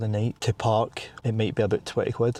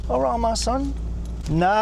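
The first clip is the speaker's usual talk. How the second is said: high-pitched Cockney greeting; squeaky mock-Cockney call; 12 semitones higher, slower than usual. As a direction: throw out a jokey high Cockney hello. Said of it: "go in Cockney head first".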